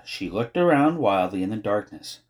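ordinary delivery, nothing marked

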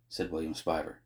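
usual speaking voice

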